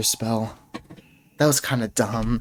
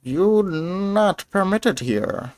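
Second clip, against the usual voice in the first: higher, slow goblin warning; a lightly voiced goblin barring the way; goblin guard; 7 semitones higher, much slower than usual. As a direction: goblin guard telling the intruder he's not allowed. Goblin warns the intruder.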